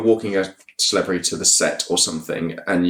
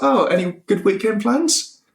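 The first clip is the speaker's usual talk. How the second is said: chirpy small talk; 11 semitones higher than usual. Retold or imitated.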